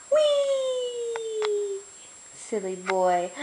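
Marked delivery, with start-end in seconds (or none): 0.1-1.8 put-on a delighted high "whee" for the baby; high playful whee. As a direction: squeal it way up high.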